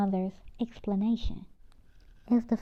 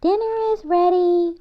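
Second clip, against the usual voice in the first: mom calling dinner from the kitchen, loud and higher; 9 semitones higher, 7 dB louder than usual. Acted. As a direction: call it across the house. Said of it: "Mom announced from the kitchen".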